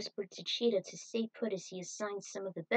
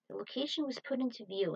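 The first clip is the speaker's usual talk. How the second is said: plain reading voice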